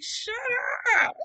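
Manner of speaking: very high, stretched-out mock whine; embarrassed protest. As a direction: squeal it at a teasing sister. Embarrassed retort to teasing sister.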